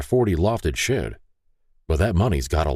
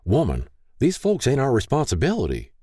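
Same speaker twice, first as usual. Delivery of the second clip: higher-pitched mild scolding; gentle chiding; 8 semitones higher than usual. A narrator's reading. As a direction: chide your wife mildly. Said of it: "Paul gently chided".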